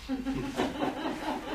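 ein hohes, gehauchtes Auflachen; kurzes Lachen. Acted. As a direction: lach kurz und hell über den eigenen Witz. Jachmann grinst über den Witz. (Jachmann lacht über seinen Witz)

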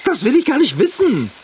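high panicky voice; frantic and disgusted; panicked. Acted. Panicked after creature on face.

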